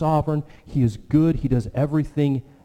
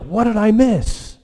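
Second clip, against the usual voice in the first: startled waking; 10 semitones higher, 5 dB louder than usual. Retold or imitated. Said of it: "you're like".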